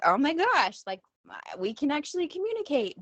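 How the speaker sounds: high-pitched imitation of astonishment; amazed realization. Host mother reacting to student's letter, imitated amazement. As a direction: act out a delighted, astonished discovery.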